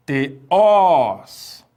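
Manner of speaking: teós with a long, loud stressed final ós; final-syllable stress. Shown as a demonstration. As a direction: say teós slowly, landing hard on the ós. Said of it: "levam acento agudo".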